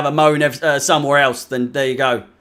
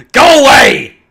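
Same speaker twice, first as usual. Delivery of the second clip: loud, high yell of go away; fed-up fan yelling at the players; shouting at the screen; 7 semitones higher, 8 dB louder than usual. Real, not acted. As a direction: bark it at the telly.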